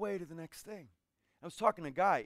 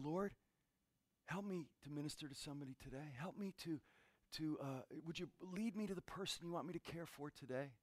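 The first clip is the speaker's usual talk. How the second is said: quiet prayer; 8 dB quieter than usual. Retold or imitated.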